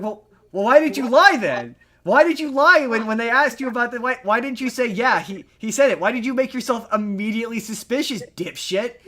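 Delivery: loud, high-pitched incredulous rant; yelling at a TV character who blew it; exasperated yell. Retold or imitated. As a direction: shout it at him like he's an idiot.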